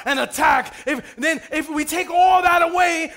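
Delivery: preaching. Acted. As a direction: drive the point home to the church. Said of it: "this is preaching".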